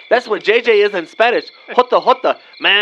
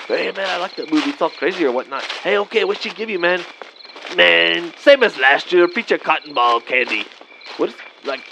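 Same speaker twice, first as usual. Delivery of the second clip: ordinary talk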